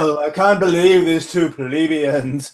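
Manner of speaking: slow, lightly voiced sniffy couturier; snooty fashion insider. Fashion house insider scoffing at the hosts, mock snooty. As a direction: look down your nose at two nobodies. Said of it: "Someone at Gucci is just".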